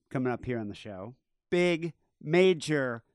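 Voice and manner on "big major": slow, building hype to a loud huge; hyped announcement. Real, not acted. Host teasing big news to listeners, drawn out and excited. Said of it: "hyping the big news".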